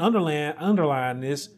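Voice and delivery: ordinary delivery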